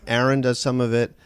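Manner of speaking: normal talking voice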